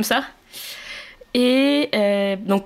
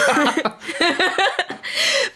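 laughing; 9 semitones higher than usual. Real, not acted. Laughs at own opera joke.

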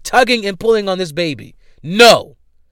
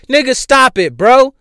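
loud, high, fed-up call to stop; real frustrated outburst; exasperated shout; 7 semitones higher, 6 dB louder than usual. Real, not acted. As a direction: yell it at him to quit. Heated rant at [name].

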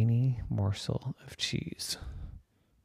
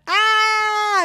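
tiny panicked squeal; high mouse shriek; 9 dB louder than usual. Acted. Scared mice shriek as they run, high and sharp.

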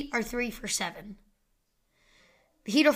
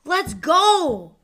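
excited cheer; 6 semitones higher, 6 dB louder than usual. Real, not acted. Shooting a mini hoop from chair.

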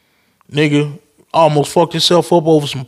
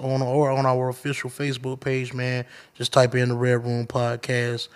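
softer, laid-back sign-off read; podcast outro; 3 semitones lower, 10 dB quieter than usual. Real, not acted. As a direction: wrap up the show, easy and low-key.

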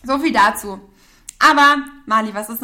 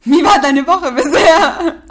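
slow, high, louder mock talk-show host; mock interviewer voice; 5 semitones higher, 11 dB louder, slower than usual. Host playfully interviewing her cohost, slow and high. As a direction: ask it like a hammed-up TV host.

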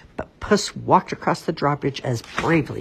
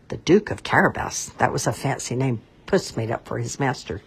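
usual speaking voice

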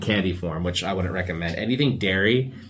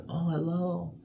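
soft high sultry hello; breathy seductive greeting; 9 semitones higher, 8 dB quieter, slower than usual. Retold or imitated. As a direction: greet the sunbeam like a lover. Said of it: "beam hits me".

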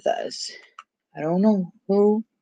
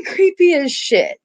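laughing; 10 semitones higher than usual. Real, not acted. Laughing at creepy song.

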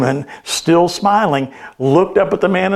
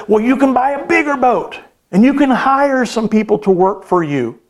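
higher, impatient businessman; irritated; 6 semitones higher than usual. A narrator's reading. Businessman irritated by the fisherman's questions, impatient. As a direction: push it, a man losing patience. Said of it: "getting a little bit irritated".

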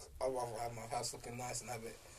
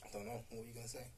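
whispering; 12 dB quieter, faster than usual. Real, not acted. Whispered aside.

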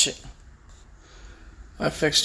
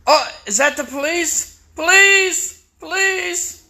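very high, loud squealing cry for help; mock police cry; 5 dB louder, slower than usual. Mocking the enemies calling the police, squealing high. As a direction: shriek like a panicked tattletale.

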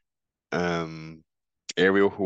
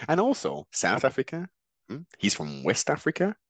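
usual speaking voice

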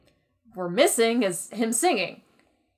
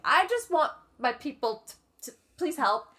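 lightly acted plea, higher pitched; earnest plea; 6 semitones higher than usual. Retelling a heroine's prayer for her people, pleading. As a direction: plead gently for your people, mildly acted. Voices Esmeralda's selfless plea.

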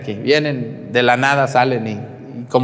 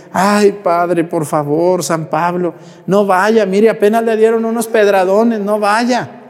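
fretful begging that climbs higher; mock pleading; 7 semitones higher than usual. Disciples beg stoned Saint Paul not to go, mock-anxious pleading. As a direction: plead with exaggerated concern, pitch rising. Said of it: "le decían".

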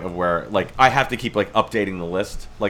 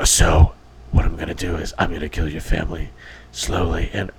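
villain whisper; 6 semitones lower than usual. Pretend. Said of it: "the whispering villain".

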